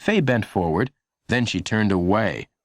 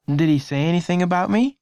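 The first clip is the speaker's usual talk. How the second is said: put-on boy's voice; 10 semitones higher than usual. A narrator's reading. Lenny voiced as a boy.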